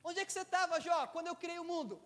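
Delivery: loud, towering voice of God; God thundering at Job; stern shout. Retold or imitated. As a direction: boom it down as God rebuking. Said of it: "ele fala assim".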